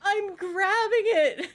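high, drawn-out protest; exasperated cry. Real, not acted. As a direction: wail it slowly in frustration at the game. Exasperated cry at failing in game.

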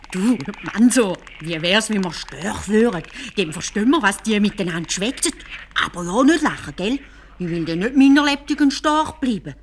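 höher und lauter, eifrig; aufgeregter Kalif. Acted. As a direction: Kalif drängt den Wesir voller Eifer. Kalif schlägt Verwandlung vor. (Kalif schlägt Mansur die Verwandlung vor, eifrig)

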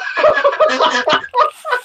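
high-pitched, sustained laughter; real uncontrolled laughing fit; helpless laugh. Real, not acted. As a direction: crack up completely. Helpless laughter after accent attempts.

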